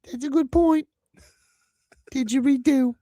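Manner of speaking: put-on high old English lady voice; a fussy little granny.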